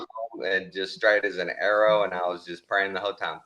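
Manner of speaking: normal talking voice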